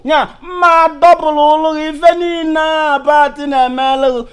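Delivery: ordinary talk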